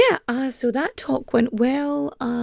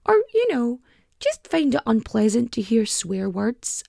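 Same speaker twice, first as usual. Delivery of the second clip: ordinary delivery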